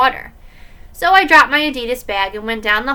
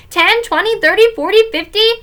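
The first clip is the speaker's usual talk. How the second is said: high, loud singsong counting; child's chanted counting; showing-off recitation; 10 semitones higher, 6 dB louder than usual. Acted. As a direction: count like a proud little kid.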